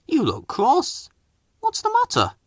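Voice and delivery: high, gentle concerned engine; kindly Toby voice. A narrator's reading. Toby asks Percy.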